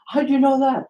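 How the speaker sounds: high, loud mock amazement; astonished reply. Caller acting out a stranger whose accent was spotted, astonished.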